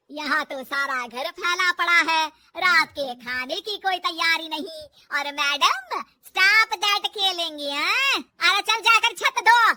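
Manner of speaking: slow, loud, sarcastic telling-off; irate mother-in-law laying down the law; angry scolding. Acted. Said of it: "गुस्सा आ जाता है".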